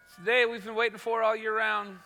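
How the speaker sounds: ordinary talk, nothing marked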